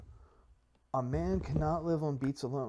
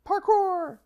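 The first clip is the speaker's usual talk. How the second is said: sudden loud high yell; excited shout; 7 dB louder than usual. Real, not acted. Yelling as he leaps in the game, loud and high. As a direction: shout it out as you leap.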